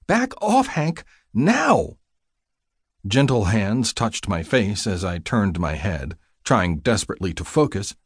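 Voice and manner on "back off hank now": high, insistent command; urgent order. Acted. Ordering Hank away from the patient, urgent high command. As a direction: order him off, high and emphatic.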